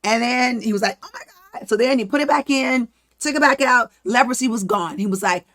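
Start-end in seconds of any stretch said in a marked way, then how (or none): 1.0-1.7 breathless whispered gasp, much higher and softer; gasped oh my God. Retold or imitated. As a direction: gasp it out in shocked awe. Moses sees leprosy vanish.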